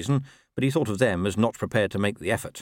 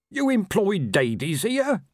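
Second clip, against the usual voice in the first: slow, high, shocked asking; shocked question; 10 semitones higher, slower than usual. A narrator's reading. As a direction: sound properly scandalised.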